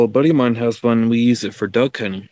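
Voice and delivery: ordinary talk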